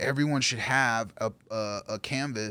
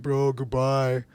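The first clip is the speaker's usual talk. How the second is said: tearful goodbye; 3 semitones higher, 5 dB louder, slower than usual. Retold or imitated. Retelling tearful goodbye to friend.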